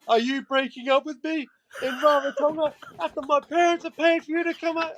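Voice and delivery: high, indignant girlfriend impression; mock outraged girlfriend. Girlfriend outraged at being dumped abroad, high mock indignation. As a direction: squeak it out like she can't believe it.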